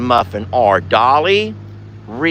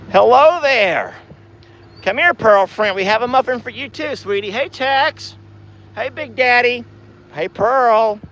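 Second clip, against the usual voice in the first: cooing to cows; 9 semitones higher than usual. Acted. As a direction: coo brightly to greet each cow by name.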